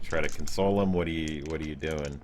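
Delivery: ordinary delivery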